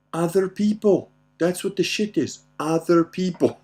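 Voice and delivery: high, loud mock lesson; dad triumphantly revealing the answer; emphatic punchline.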